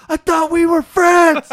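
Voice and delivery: high, loud, slow wounded cry; hammed-up betrayed wail; distant hurt call. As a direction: call after him, heartbroken. [name] calling after him.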